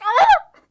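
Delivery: high, loud delighted shriek; short loud squeal of delight; fangirl scream. Retold or imitated. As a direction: let out a thrilled fangirl squeal. Reacting to the hug scene.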